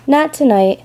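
ordinary delivery

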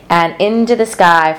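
usual speaking voice

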